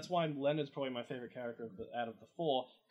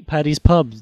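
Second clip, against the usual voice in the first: shouting; 21 dB louder than usual. Pretend. Shouting a silly pub name.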